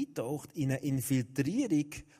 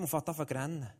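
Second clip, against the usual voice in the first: ordinary talk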